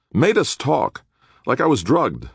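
high-pitched, aggrieved complaint; angry. A narrator's reading. Young prisoner explaining his confession, angrily. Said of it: "Nick replied angrily".

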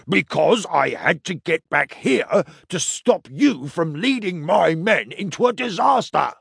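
angry, teeth clenched; through gritted teeth. A narrator's reading. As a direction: bite the words out. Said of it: "gritted his teeth".

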